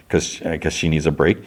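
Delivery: normal talking voice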